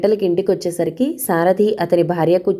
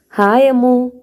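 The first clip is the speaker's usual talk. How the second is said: higher, drawn-out friendly hail; calling out a greeting; 5 semitones higher, 4 dB louder, slower than usual. A narrator's reading. Old friend Sudha hailing her from behind, calling out.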